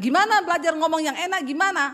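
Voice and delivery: worked-up wife in a retold quarrel; exasperated retort. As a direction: fire back quickly and loudly.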